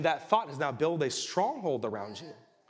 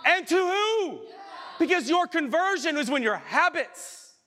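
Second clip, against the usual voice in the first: much higher and louder fiery preaching; preaching at full force; 12 semitones higher, 5 dB louder than usual. Acted. Preacher rails at the congregation about empty conversion, fiery shout. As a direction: hammer the point home to the back row.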